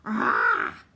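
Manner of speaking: loud sudden yell of pain; scream of pain. Acted. Solly screaming after being bitten. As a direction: howl as the bite lands. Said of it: "Solly screamed".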